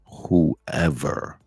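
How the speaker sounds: hushed whisper into the mic; mock-confidential whisper to the listeners; close-mic whisper. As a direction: lean in and whisper it. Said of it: "let me get closer to the mic to say this".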